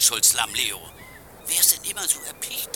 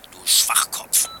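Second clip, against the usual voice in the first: whispering; 9 semitones lower, slower than usual. Acted. Villain insults partner in secret.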